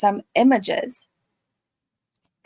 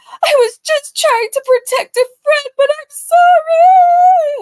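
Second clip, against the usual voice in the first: hammed-up sobbing, much higher and louder, drawn out on sorry; mock wailing; 7 dB louder, slower than usual. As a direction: wail the apology in fake tears. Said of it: "She cries".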